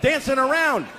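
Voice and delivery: high, strained, almost shouting; manic rant. Acted. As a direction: push it to the edge of sanity. Escalating frantic answer.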